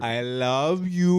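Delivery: slow, stretched coaxing; drawn-out affection. Retold or imitated. Owner coaxing his dog to talk back, drawn-out words. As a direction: draw out each word to the dog. Demonstrating calling to own dog.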